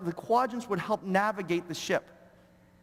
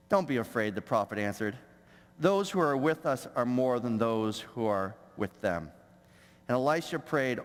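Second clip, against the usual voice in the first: lower, measured Bible reading; scripture reading; 8 semitones lower than usual. Acted. Preacher reading the verse aloud to the church, low and measured. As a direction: read the passage to the congregation, steady and low.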